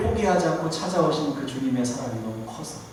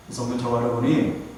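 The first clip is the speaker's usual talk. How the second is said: usual speaking voice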